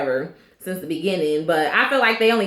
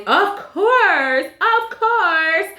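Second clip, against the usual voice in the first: much higher put-on Kelly voice; mocking Kelly's favourite line in a high voice; Kelly's catchphrase; 12 semitones higher, much slower than usual. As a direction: squeak it out like Kelly, pitched way up. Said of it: "Kelly just always".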